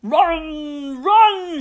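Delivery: high, loud drawn-out warning; urgent high yell; shouting. A narrator's reading. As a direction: shout to send someone fleeing. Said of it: "shouted Mum".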